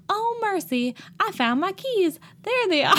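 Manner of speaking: high, hammed-up Southern belle relief. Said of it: "You're really going with this bit".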